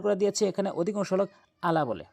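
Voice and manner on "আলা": "আলা" with a simple glottal onset, no throat squeeze; hamza for ayn. Shown as a demonstration. Teacher shows the common mistake, "আলা" with hamza in place of ayn. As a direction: say "আলা" starting on a light hamza. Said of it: "আইনের জায়গায় হামজা আইনে উচ্চারণ করে".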